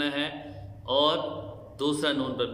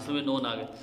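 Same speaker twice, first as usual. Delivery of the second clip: usual speaking voice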